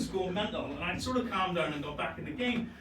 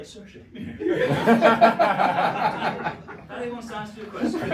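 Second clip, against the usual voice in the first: laughing; 4 semitones higher, 11 dB louder than usual. Retold or imitated. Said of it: "And I was like".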